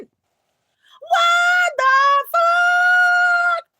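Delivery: over-the-top singing yell of disbelief; Charles Okocha voice. Retold or imitated. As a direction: stretch it into a wailing sung outcry. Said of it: "In the voice of Charles Okocha".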